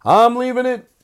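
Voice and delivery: high, loud, insistent; loud insistence. Real, not acted. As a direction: belt it out to the producer. Insists to his producer Aristotle.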